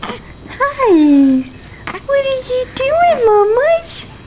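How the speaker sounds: high pretend baby voice; voicing the baby. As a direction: speak for the baby, high and sweet. Mother voicing baby, high pitch.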